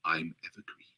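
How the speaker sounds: hushed, creaky, very low murmur; soft vocal fry. Retold or imitated. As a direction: imitate a calm app narrator with fry. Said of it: "she has a little bit of a vocal fry when she speaks in the soft tone".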